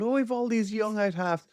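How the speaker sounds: high mocking voice of a complainer; mocking complaint. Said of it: "kinda going".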